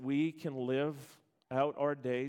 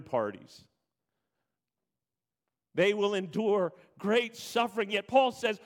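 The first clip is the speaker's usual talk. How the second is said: loud, high, driving sermon voice; full-force preaching; 7 semitones higher, 8 dB louder than usual. Acted. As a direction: preach it out to the whole church.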